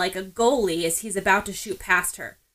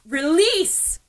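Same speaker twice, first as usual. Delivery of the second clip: sharp raised call, pitched up and louder; urgent cry; 7 semitones higher, 4 dB louder than usual. A narrator's reading. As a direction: snap out the command to release. Ochako cancels her quirk urgently.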